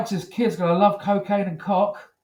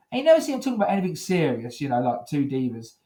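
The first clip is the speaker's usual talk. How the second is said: normal talking voice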